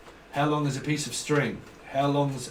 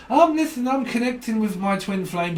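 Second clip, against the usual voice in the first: naive believer; 7 semitones higher, 3 dB louder than usual. Acted. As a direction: pipe up as an earnest believer. Acts a deluded twin-flame believer.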